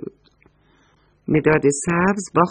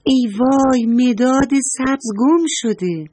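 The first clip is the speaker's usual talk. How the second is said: higher, urgent outcry of alarm; alarmed cry; 6 semitones higher than usual. Acted. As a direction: cry out that the green pencil is lost. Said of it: "نارنجی فریاد زد".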